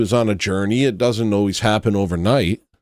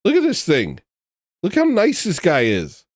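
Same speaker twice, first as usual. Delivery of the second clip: higher, tender coo at a cute animal; higher tender coo over a beluga photo; 8 semitones higher than usual. Real, not acted. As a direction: coo like talking to a pet. Cooing at beluga picture.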